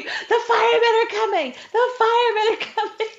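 high, laughing mock squeal; mock scream. Coworkers at fire drill squealing about firemen, high and giddy. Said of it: "a scream".

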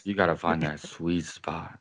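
low, mock-seductive R&B voice; teasing smooth-voice impression; imitated R&B voice. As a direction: drop low like a late-night R&B singer talking. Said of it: "he's got that R and B voice".

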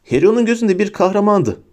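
usual speaking voice

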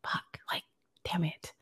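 breathy whispered curse. Retold or imitated. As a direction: curse under your breath. Retold inner reaction.

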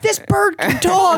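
high, slow mock amazement; hammed-up drawn-out astonishment; amazed boast. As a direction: show off a miracle bird. Re-enacting the amazed man.

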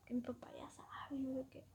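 whispered aside. Real, not acted. Telling a friend the made-up excuse, whispered. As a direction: whisper it like a secret.

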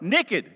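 "Naked" said Southern-style as "nekkid"; Southern pronunciation. Shown as a demonstration. Pastor showing how the South says it, "Naked" as "nekkid". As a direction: shorten the first vowel of "Naked" to "nick-id". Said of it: "That's what we say in the South".

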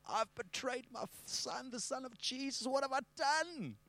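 high, hushed, weeping lament; tearful anguish. Acted. Peter weeping after betraying Jesus, anguished. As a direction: squeeze it out high and soft, as if crying. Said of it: "cried his eyes out".

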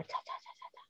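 whispered spritz noises; spray sound. Shown as a demonstration. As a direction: mimic a mist spray hitting the face. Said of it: "assim, ó".

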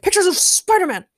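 very high, loud boss demand; J. Jonah Jameson. A narrator's reading. Boss demands photos from Daniel, shouting. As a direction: bark the questions in a put-on voice. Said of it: "shouted J. Jonah".

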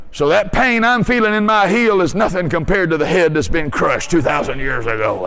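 ordinary delivery

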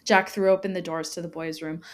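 ordinary delivery

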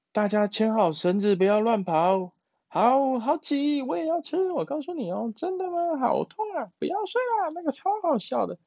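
high, fast chatter of many tiny frogs; squeaky kid voices all talking at once; little frogs chattering. Acted.

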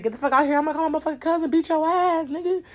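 high, loud yelling threat to make him leave; retold yelled threat. Woman yelling at her boyfriend to get out of her house. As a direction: shout it like you mean to scare him off.

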